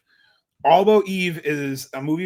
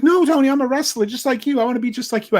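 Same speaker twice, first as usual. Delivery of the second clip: gushing fan; 8 semitones higher, 5 dB louder, faster than usual. Retold or imitated. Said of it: "And she's like".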